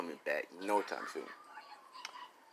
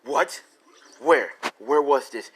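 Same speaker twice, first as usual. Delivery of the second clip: shocked officer; 3 semitones higher, 8 dB louder, slower than usual. Acted.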